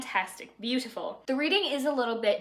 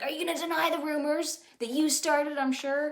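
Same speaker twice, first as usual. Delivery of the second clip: higher, haughty accusing voice; snooty aunt; 6 semitones higher than usual. Retold or imitated. Rich aunt accusing Elizabeth of rumors, haughty sneer. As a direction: sneer it down at her.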